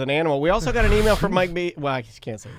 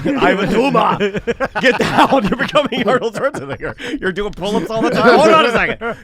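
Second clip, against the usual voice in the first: laughing; 4 semitones higher, 6 dB louder than usual. Real, not acted. Laughing while teasing Tom.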